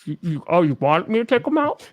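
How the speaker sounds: talking around mouthpieces, muffled; marbles in mouth. Real, not acted. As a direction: marbles in mouth, thick speech. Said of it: "sounds like you have marbles in your mouth".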